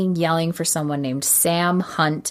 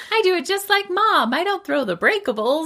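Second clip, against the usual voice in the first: put-on much higher, sing-song little girl; childish mock voice; 14 semitones higher than usual. Gloria proudly tells Susie she copies Mom, high childish voice. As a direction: play a kid proud of copying Mom.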